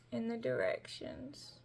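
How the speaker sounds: soft whispered aside; whispering. Real, not acted. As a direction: drop to a whisper. Trailing aside while holding recipe.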